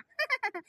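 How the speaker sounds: high mocking laugh; scornful laugh. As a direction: laugh off the accusation. (ஏளனச் சிரிப்பு)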